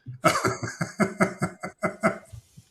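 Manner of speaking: airy unvoiced laugh; a real chuckle after the joke; breathy laugh. Real, not acted. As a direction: laugh at your own punchline. Host laughs at own joke.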